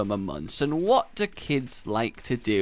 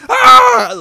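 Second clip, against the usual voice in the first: sudden high piercing scream; kid scream; 16 dB louder than usual. Retold or imitated. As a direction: let out a shrill child's scream. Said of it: "and the".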